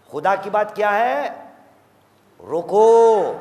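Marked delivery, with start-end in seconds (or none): 2.4-3.4 loud single order; stop, called out; sharp command. Acted. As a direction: bark the word روکو. Preacher repeats God's command.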